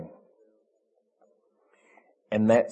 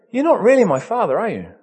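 put-on childlike daughter; 11 semitones higher, 7 dB louder than usual. Daughter's question repeated higher.

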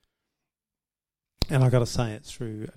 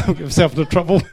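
quick, loud words through laughter; amused, raised-voice aside; laughing; 6 semitones higher, 14 dB louder, much faster than usual. Real, not acted. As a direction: laugh it off hurriedly. Laughing off a risky remark.

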